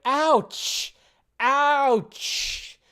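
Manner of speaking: high, pained ouch, drawn out; wincing ouch. Real, not acted. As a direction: wince out loud at what you saw. Wincing at the opening scene.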